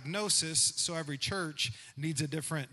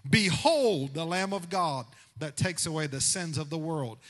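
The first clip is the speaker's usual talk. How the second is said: preaching; 6 dB louder than usual. Acted. Preacher proclaims scripture.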